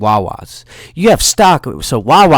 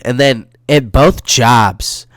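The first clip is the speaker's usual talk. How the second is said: ordinary talk, nothing marked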